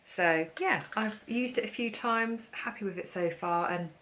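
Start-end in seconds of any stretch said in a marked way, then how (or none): none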